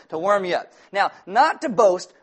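usual speaking voice

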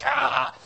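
high-pitched startled cry; mock jump-scare "Ah!"; startled yell. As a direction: yelp as if the doorbell startled you. Startled by the doorbell, retold.

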